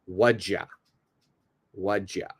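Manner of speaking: "what'd ya" fused to "wadja"; reduced whatcha. Shown as a demonstration. Teacher showing the reduced form "what'd ya". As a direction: squeeze "what'd ya" into one word. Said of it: "It becomes".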